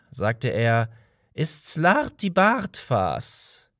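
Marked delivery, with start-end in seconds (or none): 1.3-3.7 slow, deliberate, a little higher; slow solemn name. A narrator's reading. Old man telling Arthur his name, slow and deliberate. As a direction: weigh each syllable of Slarti Bartfas.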